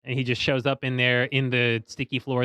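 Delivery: ordinary delivery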